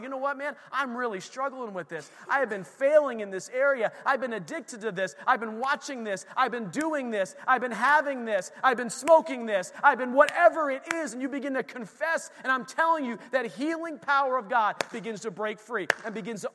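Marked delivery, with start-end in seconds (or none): 0.0-11.1 louder, higher, pressing confession; confessing urgently. Retold or imitated. Believer pouring out struggles to a fellow believer, rising and loud. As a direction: build it up as you own each sin. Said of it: "call a fellow believer up and say".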